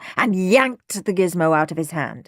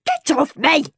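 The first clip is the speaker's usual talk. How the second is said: raised, higher-pitched shout; 9 semitones higher, 4 dB louder than usual. A narrator's reading. Henry to the brother clutching his leg, shouting. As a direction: shout to shake him off. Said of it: "shouted Henry".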